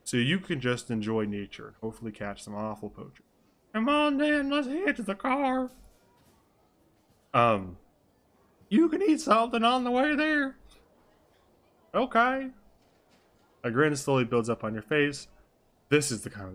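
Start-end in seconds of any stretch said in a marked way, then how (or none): 3.7-5.7 high falsetto sidekick; high-pitched eager character; Flop urging on. Acted. Flop urging friend to the car. 8.7-12.5 high falsetto sidekick; high-pitched character chat. Acted.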